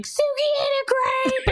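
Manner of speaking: put-on drawn-out high kid tattling. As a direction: report it like children telling on someone. Kids reporting dog mishap.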